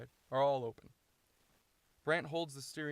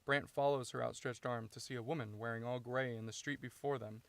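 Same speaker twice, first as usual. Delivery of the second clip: ordinary talk, nothing marked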